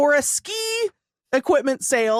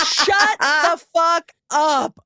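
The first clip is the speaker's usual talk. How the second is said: incredulous outburst; 6 semitones higher, 4 dB louder, much slower than usual. Real, not acted. Friend's outrageous opinion.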